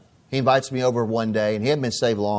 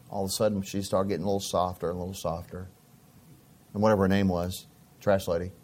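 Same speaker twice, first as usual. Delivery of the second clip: ordinary delivery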